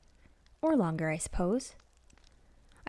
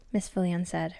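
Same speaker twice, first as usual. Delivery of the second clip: usual speaking voice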